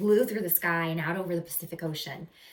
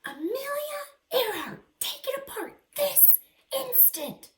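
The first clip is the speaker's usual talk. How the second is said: put-on high, stern old-lady voice; scolding grandma. Grandma ordering Amelia to take it apart, in a high stern voice.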